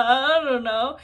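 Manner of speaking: loud, drawn-out nervous dodge. Retold or imitated. Stalling when asked for a wish, nervous dodge. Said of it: "and then I got nervous".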